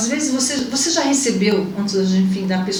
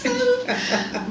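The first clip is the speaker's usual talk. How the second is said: playful echo; 14 semitones higher, 5 dB quieter, much slower than usual. Real, not acted. Echoing the host's joke.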